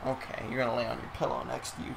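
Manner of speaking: settling the dog on his pillow, softly and quickly; quiet aside to dog. Real, not acted.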